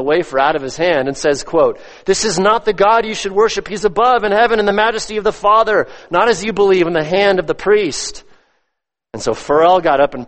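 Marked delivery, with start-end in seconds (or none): 2.0-8.2 high-pitched, forceful quoted protest; impassioned rebuke. Retold or imitated. Reformer rebukes the priest at mass, quoted in high, impassioned preaching. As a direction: retell the reformer's challenge with rising fervour.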